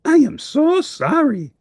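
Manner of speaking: high, slow, earnest apology; apologetic Grover. Acted. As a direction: sound truly sorry in a high monster voice. Grover apologizing to Cookie Monster.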